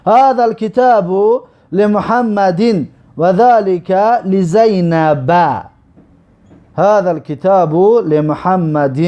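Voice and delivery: slow, careful recitation of هذا الکتاب لمحمد; slow Arabic reading. Shown as a demonstration. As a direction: read the Arabic sentence out slowly for students. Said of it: "این را براتون میخونم". (خواندن آهسته و شمرده عربی)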